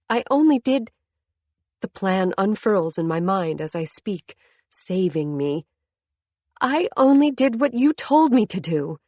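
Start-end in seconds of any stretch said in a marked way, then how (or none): 0.1-0.9 higher, tense and hurried; anxious. Acted. Wife blurts defence. 6.6-9.0 higher, pressed and insistent; defiant. Acted. Wife accuses husband back.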